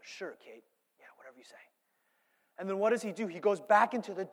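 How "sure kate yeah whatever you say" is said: low, soft mobster brush-off; quiet dismissive lie. Retold or imitated. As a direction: imitate a husband falsely agreeing, barely audible. Voicing Michael lying to his wife.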